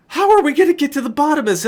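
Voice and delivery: losing patience and it shows; high, fast, frustrated challenge; exasperated. Retold or imitated. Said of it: "I'm about to lose it".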